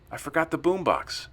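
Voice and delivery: high-pitched, urgent exclamation; panicked dismay. Acted. Narrator to Daniel after fleeing the river, dismayed and panicky. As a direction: cry it out in sudden dismay.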